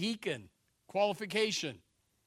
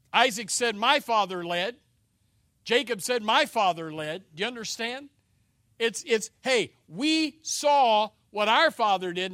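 loud proclamation; 2 semitones higher, 10 dB louder than usual. Real, not acted. Preacher proclaims sons' words.

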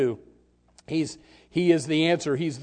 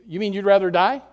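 ordinary delivery

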